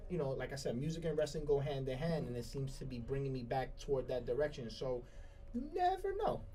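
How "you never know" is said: high falsetto tease. Acted. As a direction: lift it way up playfully. Playful musing after music talk.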